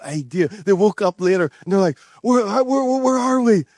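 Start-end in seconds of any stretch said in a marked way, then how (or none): 2.2-3.6 higher, bewildered stammering; confused waking. Retold or imitated. Rescued boys waking in a strange place, mock bewildered. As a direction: sound like a boy waking up lost.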